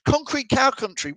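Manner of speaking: reads the place name with a high, baffled lift; puzzled reading. Real, not acted. Host reading a viewer's location, confused by it. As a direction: say it like you have no idea where that is. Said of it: "You've absolutely lost me".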